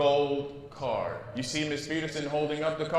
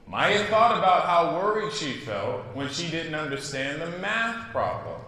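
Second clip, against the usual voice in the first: ordinary delivery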